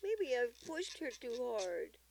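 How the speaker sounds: high, loud fretful confession; worried grandmother. A narrator's reading. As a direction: voice the worry high and pressing. Worried grandmother voiced in a story.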